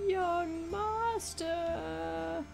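put-on slow, drawn-out high old man call. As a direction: stretch out young master like an old servant. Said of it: "I'm just doing old man voice".

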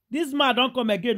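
usual speaking voice

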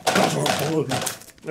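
spluttering on the word; spraying spit mid-word; spit take. Real, not acted. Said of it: "I just spat everywhere".

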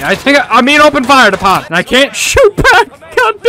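loud, high-pitched and rising; panicked frustration. Real, not acted. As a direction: yell helplessly while under fire. Player under fire, cannot shoot back.

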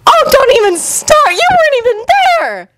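high, sharp retort; irritated high-pitched snap; snapping. A narrator's reading. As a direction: snap back in a high girl voice. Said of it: "Sakura snapped".

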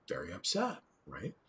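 usual speaking voice

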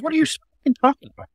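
incredulous. Real, not acted. To liberals' claims, a high incredulous outburst. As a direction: pitch leaps up in disbelief, quick.

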